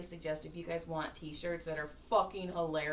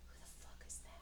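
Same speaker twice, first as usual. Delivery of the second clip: hushed whisper under the breath; a secret whispered aside; 18 dB quieter, much faster than usual. Retold or imitated. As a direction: whisper it so she can't hear. Whispered aside about stranger.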